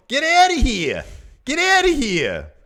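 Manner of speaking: high, loud mock Australian yell; bad Australian accent. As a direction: shout it in a hammy Australian accent. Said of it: "It was a terrible Australian accent".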